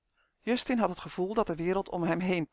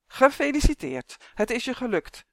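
high, mildly angry congratulations; angry call; 6 semitones higher, 4 dB louder than usual. A narrator's reading. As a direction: congratulate with a touch of anger. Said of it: "riep ze boos". (een tikje boos, hoog uitgeroepen)

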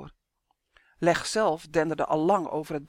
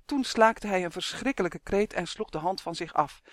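ordinary delivery

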